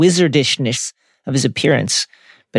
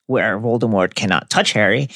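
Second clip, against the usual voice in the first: ordinary talk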